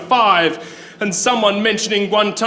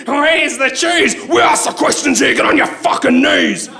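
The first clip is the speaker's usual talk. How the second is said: interrogator shouting; 4 semitones higher than usual. Acted. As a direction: bark orders like a brutal interrogator. Interrogator barks at poet.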